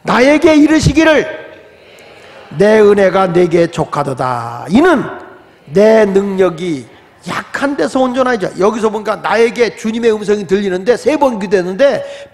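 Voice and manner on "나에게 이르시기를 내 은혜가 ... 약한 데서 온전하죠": slow, loud chanted reading of the verse; congregational scripture reading. Acted. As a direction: lead the church through the text slowly. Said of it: "다 같이".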